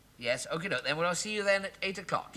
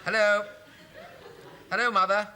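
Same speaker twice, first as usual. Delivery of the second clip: calling into phone; 6 semitones higher, 5 dB louder than usual. Acted. Line gone silent, calling louder.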